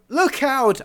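very high, alarmed cry; yelled warning. A narrator's reading. Warning companion of the swinging tail, alarmed yell. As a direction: yelp a sudden warning. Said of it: "I yelled".

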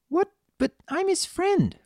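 put-on very high, childlike protest; young pony Pinky. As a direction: protest in a small filly's high voice. Child character in story.

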